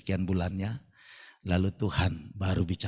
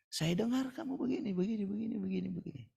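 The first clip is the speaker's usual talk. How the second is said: much higher, softer voice for the visitor; visitor's voice; 11 semitones higher, 7 dB quieter than usual. Retold or imitated. Pak Selnop's visitor telling the pastor he heard of his trouble, higher voice. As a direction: put on a higher voice for the caller. Stranger quoted speaking to pastor.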